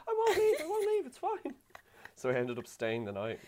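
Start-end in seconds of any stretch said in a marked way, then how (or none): 0.1-1.5 very high, squeaky mock promise; panicked reassurance. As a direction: squeal it to placate someone crying. Retold panicked reassurance of crying partner.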